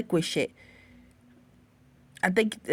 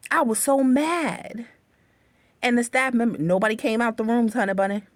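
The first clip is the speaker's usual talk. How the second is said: normal talking voice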